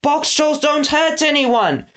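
high, drawn-out protest; pleading boy hero. Acted. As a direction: plead for your friends in a high, slow voice. Eggs pleading with Snatcher in story.

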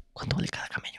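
unvoiced conspiratorial whisper; joking whisper. Joking about selling his girlfriend for camels, whispered. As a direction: whisper it like you're tempted.

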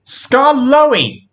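high, sudden cry of the name; high-pitched exclamation; exclaimed. A narrator's reading. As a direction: call it out as a bright idea strikes. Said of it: "he exclaimed".

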